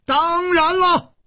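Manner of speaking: very high, drawn-out yell; roaring. A narrator's reading. Li Yuhan defiantly answering Hu Tiehua, roaring. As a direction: roar it back at the top of the range. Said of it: "李玉涵也大吼着".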